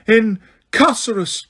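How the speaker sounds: high, slow shrieked incantation; screamed spell. A narrator's reading. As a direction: hurl the spell in panic.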